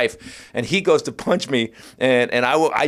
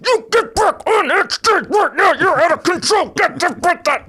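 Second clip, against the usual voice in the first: very high, slowed screech; hammered-out mock screaming in the face; wife's furious tirade; 4 dB louder than usual. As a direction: screech each word like an enraged wife. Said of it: "She gets two inches from my face".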